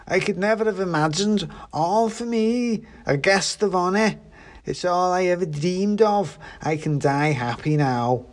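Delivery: put-on blissfully drunk character voice, pitched high; drunk pudding, overjoyed. A narrator's reading. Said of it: "has definitely been drinking".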